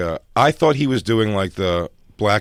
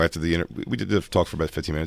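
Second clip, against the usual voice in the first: normal talking voice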